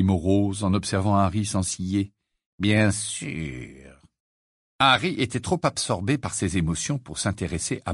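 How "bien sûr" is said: low, slow, grave-deep voice; sepulchral voice. Acted. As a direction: speak like a voice from the tomb. Morose's gloomy voice.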